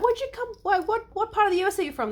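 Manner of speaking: high, quick questioning; eager questioning. Retold or imitated. Acts out someone asking origin.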